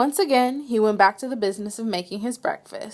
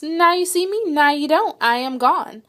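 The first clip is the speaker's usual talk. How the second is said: playful mouse; 6 semitones higher than usual. Acted.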